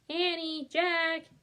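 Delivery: high, loud distant call. A narrator's reading. Mom calling her children from the house. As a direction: call their names loud across the yard. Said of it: "she called".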